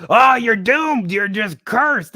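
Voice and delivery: high, loud, drawn-out cry of doom; mock doomsayer. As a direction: proclaim a curse like an over-the-top preacher. Mock doom-preacher he disavows.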